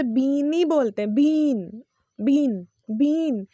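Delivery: "बीन" with a long EE; wrong pronunciation. Shown as a demonstration. Teacher shows how learners read it, "बीन" mispronounced with long ee. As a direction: stretch "बीन" slowly as beeen. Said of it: "It's not bean".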